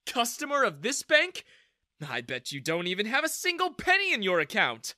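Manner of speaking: mocking. A narrator's reading. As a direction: scoff loudly at the customer. Manager ridicules the customer.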